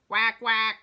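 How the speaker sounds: loud quacking mama duck call; quacky mother duck calling her brood. Acted. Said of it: "Mama Duck called to her brood".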